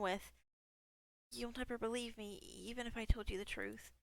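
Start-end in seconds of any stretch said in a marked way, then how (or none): 1.3-3.9 bad woman voice. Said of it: "I'm horrible with voices".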